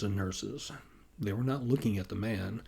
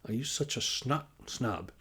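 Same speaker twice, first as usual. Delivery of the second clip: put-on Northern European accent; 3 semitones higher than usual. A narrator's reading. Nordstrom's accented voice teasing him.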